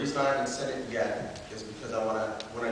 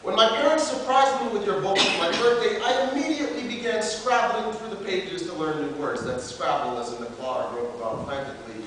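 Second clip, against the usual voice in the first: louder, declaimed formal letter; open letter performance; 8 semitones higher, 6 dB louder than usual. Acted. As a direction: read it out like a slam piece.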